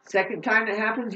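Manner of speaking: normal talking voice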